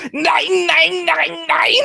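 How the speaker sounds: very high, stretched German "nein" cries; mock German yell. German player smashing shins, very high mock shrieks of nein.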